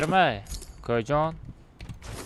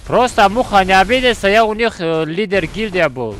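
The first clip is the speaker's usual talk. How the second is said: raised, higher voice calling out; loud calling out; 6 semitones higher, 9 dB louder than usual. Real, not acted. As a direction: call it out loud to him. Calling out to rival Mukha.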